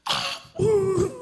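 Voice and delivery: high tearful cry of mercy; high sob. Acted. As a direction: break into a high sobbing plea. End of acted prayer.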